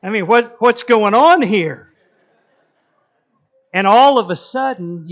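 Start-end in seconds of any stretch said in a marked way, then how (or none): 0.0-1.9 mock surprise. Husband baffled by hot meal.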